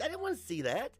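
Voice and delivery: high-pitched appalled protest; hammed-up scandalized questioning; mock dismay. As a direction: play the unwilling witness demanding why. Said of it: "And I'm like".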